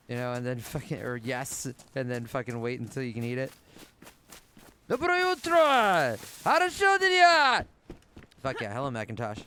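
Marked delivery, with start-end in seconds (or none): 4.9-7.6 high, slow, loud sing-song Russian greeting; over-the-top Russian greeting, high and slow; hammed-up Russian greeting. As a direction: boom it out like a cheery cartoon Russian.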